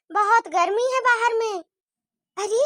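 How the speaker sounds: ordinary talk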